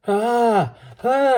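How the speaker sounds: high, loud terrified cry; long high-pitched "ah" screams; shriek. A narrator's reading. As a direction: shriek out in sudden panic. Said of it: "I shrieked again".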